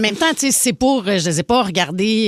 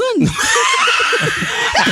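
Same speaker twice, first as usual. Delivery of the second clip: high shrieking laughter. Real, not acted. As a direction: burst out cackling. Bursts laughing after joke.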